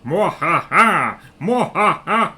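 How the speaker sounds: loud mock villain laugh; evil cackle. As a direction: cackle like a panto baddie. Evil laugh after kill chant.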